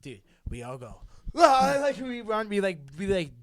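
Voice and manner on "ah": high loud startled scream; loud panicked yelp. Retold or imitated. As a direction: shriek as you scatter. Friends scattering from headlights.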